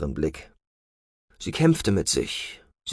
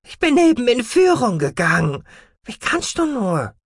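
high, loud angry outburst; 14 semitones higher, 3 dB louder than usual. Acted. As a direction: snap at her father indignantly. Angry daughter protests father.

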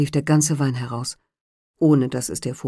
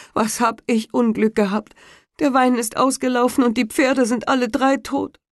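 higher, complaining husband; moaning carter; 7 semitones higher than usual. A narrator's reading. Carter complaining to his wife, raised lament. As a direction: grumble out his bad luck.

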